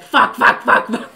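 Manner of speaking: loud, rapid-fire mimicked swearing; defiant child. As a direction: imitate a kid blurting it out repeatedly. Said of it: "Ella turned around and said".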